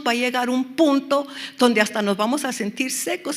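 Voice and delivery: ordinary talk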